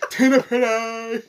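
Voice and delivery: loud, high, drawn-out call of Tina, pretty; mock shout. Imitating the comic calling out to Tina, loud and high. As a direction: belt the name out high and slow. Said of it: "And then he just".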